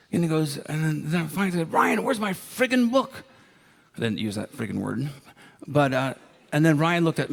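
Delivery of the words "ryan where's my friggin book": pitched way up, slow and exasperated; mock outrage at the friend who kept the book; exasperated demand. A boy demanding his borrowed book back, exasperated and pitched way up.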